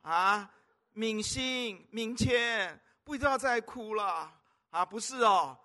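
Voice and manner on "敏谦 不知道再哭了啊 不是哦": ordinary talk, nothing marked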